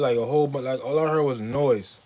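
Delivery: ordinary talk, nothing marked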